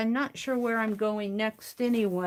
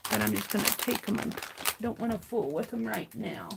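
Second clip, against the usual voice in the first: ordinary delivery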